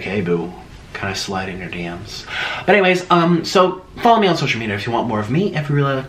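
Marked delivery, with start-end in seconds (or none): 0.0-2.3 low, soft mock-sleazy come-on; creepy flirt. Mocking a creeper sliding into DMs, low and soft. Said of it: "be a creeper and be like".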